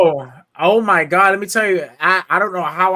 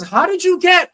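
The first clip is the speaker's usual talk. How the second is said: high, louder outburst of disbelief; boss's much higher, louder outburst of disbelief; shocked boss; 12 semitones higher, 8 dB louder than usual. Retold or imitated. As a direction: demand it in shock. Boss shocked he knows no Spanish.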